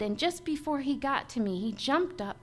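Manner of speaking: usual speaking voice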